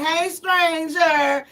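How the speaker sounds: slow, coy hey stranger; flirty come-on voice; flirty greeting. Pretend. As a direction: stretch it out sweetly. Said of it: "I'll be like".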